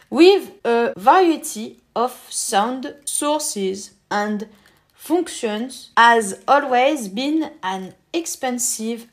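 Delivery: slow, careful English with a French accent. Real, not acted. Presenter reads the pack description himself, slow French-accented English. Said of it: "désolé pour mon accent anglais".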